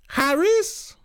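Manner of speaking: put-on old Trinidad aunt screaming a name; shrill scream. A narrator's reading. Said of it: "Tanti scream out".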